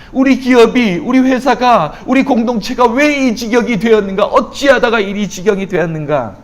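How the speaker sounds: high, insistent preaching cry; impassioned lament. Acted. Preacher lamenting church, home and company, building anguished cry. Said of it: "이렇게 탄식하고 있습니다".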